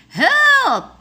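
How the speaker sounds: high, loud desperate yell; cry for help. A narrator's reading. Witch calls for rescue, screaming high and loud. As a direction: scream for help as the dragon nears. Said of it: "cried the witch".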